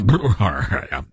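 short low gurgle in the throat; throaty gurgle. Pretend. Host breaks off and wants a lozenge, throaty gurgle. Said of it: "get me a throat lozenge".

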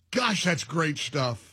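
ordinary delivery

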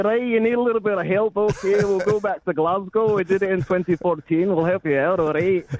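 lightly hammed-up Scottish, helping out the Aussies; Scottish accent. Reporter voicing the Scots offering help, light Scottish accent. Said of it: "as good as your Scottish one".

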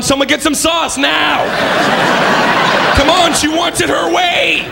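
high, slow call to the kitchen. Retold or imitated. Worker calling to the kitchen.

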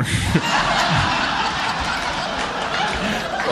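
high wheezing laugh; big laugh. Real, not acted. Comic laughing at his own punchline, wheezing. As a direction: break up laughing. Crowd laughs at the marker smell.

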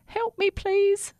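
high, slow plea for help; pleading little toothbrush. Acted. Lost toothbrush pleads with rat.

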